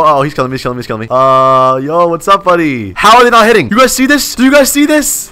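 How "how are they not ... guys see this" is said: high-pitched, agitated appeal; exasperated, raised voice; frustrated disbelief. Real, not acted. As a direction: cry out for viewers to witness it.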